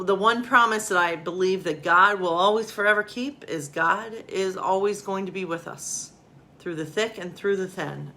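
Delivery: plain reading voice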